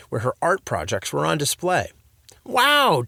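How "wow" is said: loud, high wow; loud exclamation. A narrator's reading. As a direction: exclaim it loudly. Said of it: "Dad said loudly".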